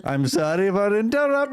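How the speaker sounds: very high, excitable mock Indian voice. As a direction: push the Indian accent up high, breaking into laughs. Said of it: "worst fucking Indian accent".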